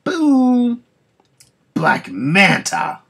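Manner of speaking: booming, high, drawn-out hype call; big, slow announcer shout; dramatic announcement. Acted. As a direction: announce the villain like a wrestling entrance. Announcing villain's entrance.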